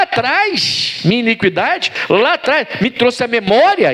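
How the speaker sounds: lightly acted, high-pitched indignant plea; a hint of an aggrieved woman arguing back; protesting widow. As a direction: protest your obedience to the prophet in preacher's fervour. Acts out widow protesting to Elias.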